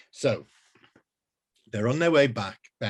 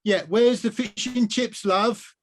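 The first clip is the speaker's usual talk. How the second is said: much higher, louder mum; 11 semitones higher, 6 dB louder, slower than usual. Acted. Mum on the phone asking for fish and chips. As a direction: call like an impatient mum. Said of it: "said her mum".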